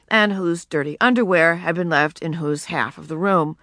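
ordinary delivery

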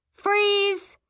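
loud high yell. Acted. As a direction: shout it across the table. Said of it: "at the top of her lungs".

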